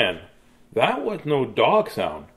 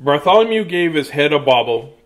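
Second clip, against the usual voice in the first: plain reading voice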